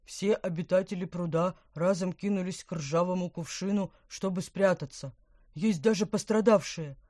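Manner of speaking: loud excited fish gurgle; agitated gurgling. Acted. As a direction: bubble over with agitation. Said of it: "Помпон булькал и булькал, разволновался".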